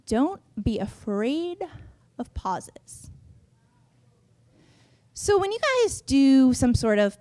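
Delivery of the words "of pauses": whispered. Shown as a demonstration. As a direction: drop to a whisper. Dramatic hushed pause tip.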